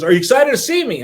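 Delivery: high, loud challenge; retold insistent question. Manager confronts the reporter over his remark, insistent question. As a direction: raise pitch and volume to press the question.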